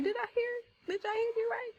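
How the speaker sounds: high-pitched double-checking; David's incredulous question. Acted. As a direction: act out a young man checking what was heard. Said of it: "And he's like".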